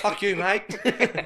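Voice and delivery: high, loud, slow Aussie retort; hammed-up Australian; mock Australian. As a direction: draw it out in a joke Australian accent. Riffing as fake Australians.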